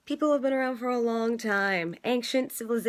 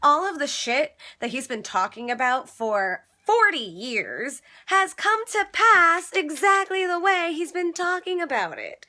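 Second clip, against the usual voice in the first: real exasperation; 6 semitones higher, 5 dB louder than usual. Real, not acted. Defending Bernie against critics.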